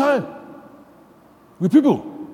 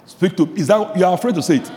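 usual speaking voice

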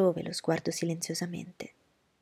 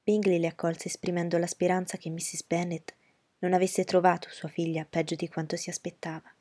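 ordinary delivery, nothing marked

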